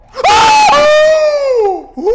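high startled cry; being chased. Real, not acted. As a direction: shriek in fright. Jump scare. (urlo mentre è inseguito)

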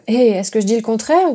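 ordinary delivery, nothing marked

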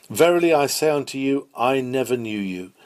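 normal talking voice

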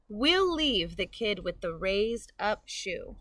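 plain reading voice

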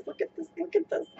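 hushed. Real, not acted. Hushed over the skirt.

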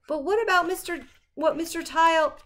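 high anxious pleading; worried. Acted. Boy worried about the governor.

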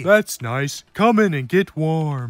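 very low-pitched grandfather voice; grandpa. Acted. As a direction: play a grandpa, voice dropped right down.

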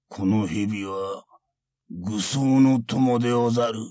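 put-on an unhurried old monk; creaky wise old man. Monk speaks calmly.

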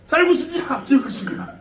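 high opening yell, dropping after; mock knife-wielding threat. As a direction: play a general spirit threatening with a blade. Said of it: "딱 칼 들고 딱 이러는 거야".

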